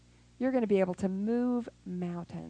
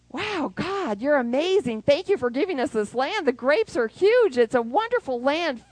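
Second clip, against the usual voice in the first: higher, louder delighted praise; excited Joshua; 4 semitones higher, 5 dB louder than usual. Retold or imitated. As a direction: marvel at the land. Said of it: "He was like".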